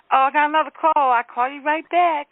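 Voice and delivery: high, singsong polite excuse; sweet brush-off. Retold or imitated. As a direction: sweetly make an excuse to hang up. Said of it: "It's always sweet".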